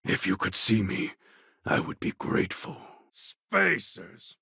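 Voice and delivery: usual speaking voice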